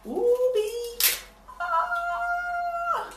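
squealing high with delight; high excited squeal. Real, not acted. Vlogger squeals at her cheap stapler piercing kit. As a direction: squeal like a thrilled shopper.

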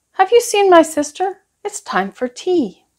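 put-on high boyish voice asking; little boy asking. A narrator's reading. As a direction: sing it up like an eager small boy. Ben asking Honest John.

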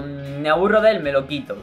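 ordinary delivery

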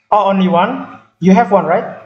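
ordinary talk, nothing marked

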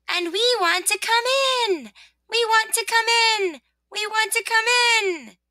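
high, insistent pleading chant; clamor high, repeating it eagerly; two little voices. Acted. Sun and rain begging rosebud for entry, insistent. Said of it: "said their two little voices".

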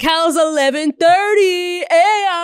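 slow, high party-hype shout; hype party host. Pretend. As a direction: whip up the crowd. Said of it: "and they're like".

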